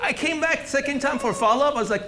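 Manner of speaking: ordinary talk, nothing marked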